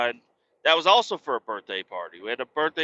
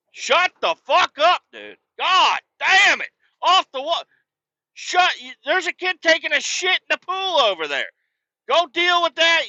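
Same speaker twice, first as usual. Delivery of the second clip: retold furious yelling; 5 dB louder than usual. Dad yelling at lifeguards about the pool, hammed-up rage. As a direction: roar the insults at the lifeguard. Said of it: "get fired up at the pool".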